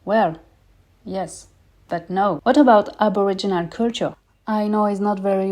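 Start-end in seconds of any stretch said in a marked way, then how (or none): none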